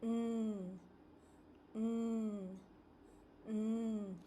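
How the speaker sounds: "n" hummed with tongue on the ridge; a held nasal "n"; isolated n sound. Shown as a demonstration. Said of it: "the next sound is".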